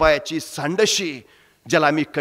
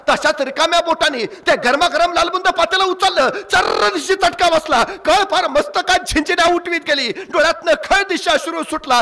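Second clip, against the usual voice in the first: dramatic build; 13 semitones higher than usual. A narrator's reading. Mother burns her fingers.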